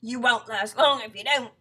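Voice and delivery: loud, ominous warning; threatening husband. Acted. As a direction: scare her into obeying. Husband threatens wife in story.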